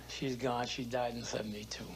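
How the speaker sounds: whispered, barely voiced; hushed grief. Real, not acted. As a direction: let it drop to a whisper. Widower recalling wife's death.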